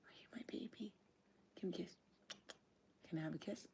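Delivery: very soft, half-whispered doting on a pet; tender cooing. Real, not acted. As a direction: murmur love close to your dog. Murmuring affection to her dog.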